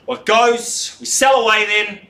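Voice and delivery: high, loud closing call; loud auctioneer call. Real, not acted. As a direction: call the sale out. Auctioneer calling the sale.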